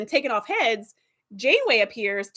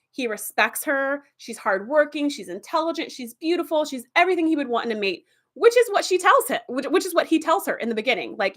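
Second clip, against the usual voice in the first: usual speaking voice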